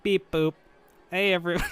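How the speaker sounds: a lightly voiced robot voice. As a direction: say it like a cheerful robot. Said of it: "here's my robot voice".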